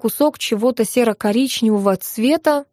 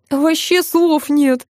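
slow, higher moan of admiration; blissful moan; 6 semitones higher, much slower than usual. A narrator's reading. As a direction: moan it out, drawing the words. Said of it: "простонал Сеня". (протяжно простонать от восторга)

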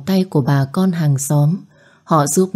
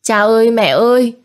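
high, louder desperate cry; girl screaming for her mother and father; child screaming for parents; 6 semitones higher, 3 dB louder than usual. A narrator's reading. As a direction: call out to drowning parents. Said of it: "Nó hét to".

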